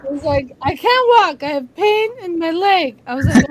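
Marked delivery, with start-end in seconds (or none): 0.6-3.0 much higher and louder, a whimpering, pained mock wail; moaning dad.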